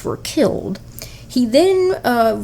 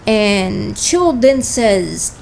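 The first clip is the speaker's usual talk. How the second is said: usual speaking voice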